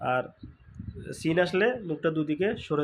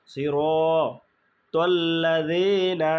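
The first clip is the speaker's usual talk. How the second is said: slow recitation; 4 dB louder, slower than usual. Shown as a demonstration. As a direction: chant it slowly.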